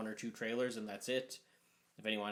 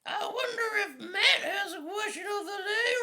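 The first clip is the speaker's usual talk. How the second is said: very high, loud squeaky announcer; wrestling announcer; 5 dB louder than usual. Acted. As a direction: shriek it like a ring announcer. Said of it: "start talking like a wrestling announcer".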